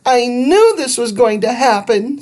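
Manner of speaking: put-on high, worried kid voice; a girl sure trouble is coming; fretful girl. Judy to Andrew about the dog, high and worried.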